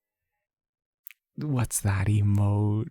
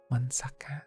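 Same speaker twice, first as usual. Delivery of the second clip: hushed quick aside; whispered aside; 2 semitones higher, 5 dB quieter than usual. Real, not acted. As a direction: drop to a whisper briefly. Aside while switching model.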